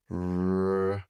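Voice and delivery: "R" held long as a phonics sound; one sustained starting sound of "R"; isolated R sound. Shown as a demonstration. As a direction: stretch "R" into "rrrr", not the letter name. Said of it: "starts with that R sound".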